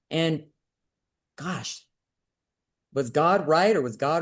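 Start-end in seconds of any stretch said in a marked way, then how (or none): none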